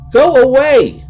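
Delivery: high loud terrified scream. Acted. Sandra screaming at the creatures outside. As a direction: scream them away from the door. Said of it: "she screamed at them".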